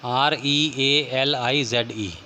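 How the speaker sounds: letters spaced out slowly; teacher spelling a word slowly for students. Shown as a demonstration. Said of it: "Z हो जाएगा".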